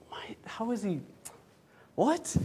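hushed sputter rising to high "what"; mock disbelief. Sulking kid baffled by happy brother, incredulous sputter. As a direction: stammer softly, then squeak in disbelief.

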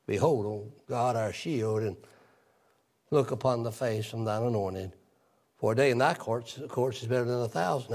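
a measured, reverent reading of the psalm; scripture reading. Real, not acted. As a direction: read the verses steadily like scripture. Reads psalm verses.

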